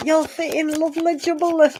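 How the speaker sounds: hint of a Cockney market trader, teasing; mock Cockney cheer. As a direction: play a chirpy Cockney wide boy. Cheeky send-off to a viewer.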